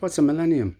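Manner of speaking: high, puzzled question; a bewildered man asking what a millennium is. Retold or imitated. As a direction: ask it like you've never heard the word. Homeless man baffled by new word.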